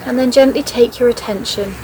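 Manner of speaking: measured meditation voice, leaving space after each phrase; guided meditation. Acted. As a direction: guide a listener gently into noticing the breath and body. Leads a guided body scan.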